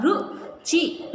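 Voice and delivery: Ruchi stretched very slowly; teacher stretching Ruchi; slow sounding out. Shown as a demonstration. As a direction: draw out Ruchi syllable by syllable. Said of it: "हो जाएगा रु".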